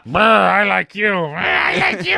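high shouted puppet squeal; foot puppet voice. Retold or imitated. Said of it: "his feet with painted faces".

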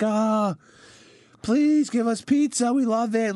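high, slow pleading fan voice; begging crowd. Pretend. Crowd at the motorcade begging for pizza, high pleading voice. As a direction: beg for pizza like a crazed fan.

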